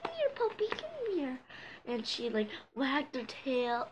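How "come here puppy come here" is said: high, sweet calling voice; calling a puppy. Retold or imitated. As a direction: coax the puppy over in a high call. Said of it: "called her, like".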